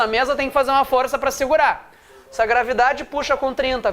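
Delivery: usual speaking voice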